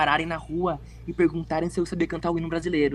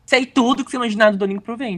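ordinary delivery

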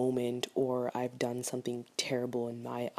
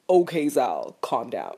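telling herself off; 9 dB louder, slower than usual. Retold or imitated.

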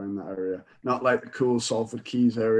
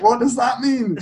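high, louder puzzled question; baffled question; 11 semitones higher, 4 dB louder than usual. Retold or imitated. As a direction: sound thrown by his reaction. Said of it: "And I was like".

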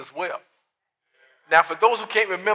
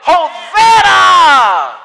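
long, high, loud drawn-out shout; shouted Hosanna; 12 semitones higher, 14 dB louder, much slower than usual. Acted. Crowd greeting Jesus, long shouted praise. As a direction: hold it out like a cry of praise.

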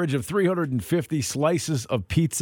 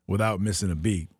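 ordinary talk, nothing marked

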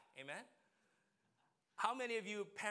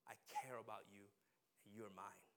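tender God; 7 semitones lower, 8 dB quieter than usual. Acted. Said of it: "God of the universe is gonna say".

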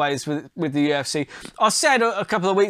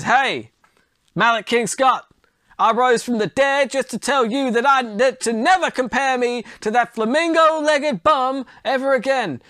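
raised-pitch boxer voice, not really Ali; a loose Apollo Creed impression, pitched up; Apollo Creed-ish voice; 6 semitones higher than usual. Muhammad Ali rising from the dead to taunt Malik King Scott, pitched-up Creed-like voice. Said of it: "That sounded more like Apollo Creed".

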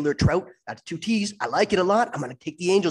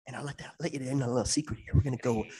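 normal talking voice

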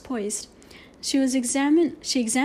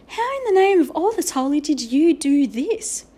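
astonished demand, higher and louder; astonished teacher; 5 semitones higher, 6 dB louder than usual. A narrator's reading. Teacher confronting Omri over his drawing, astonished. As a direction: ask it in loud, baffled amazement.

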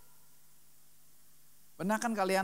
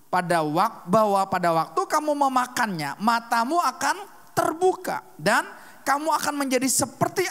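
loud, raised bible reading from the pulpit; scripture reading; 5 semitones higher, 8 dB louder than usual. Acted. Preacher reads Genesis to the youth, loud and raised. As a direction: proclaim the verses to the congregation.

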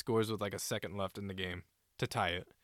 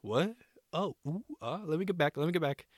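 startled realization; 6 semitones higher than usual. Retold or imitated. Goalie realizing his mistake.

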